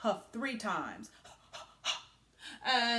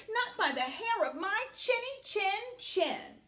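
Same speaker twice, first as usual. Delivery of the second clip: defiant pig; 10 semitones higher than usual. Acted. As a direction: pipe up like a small pig. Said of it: "said Perry".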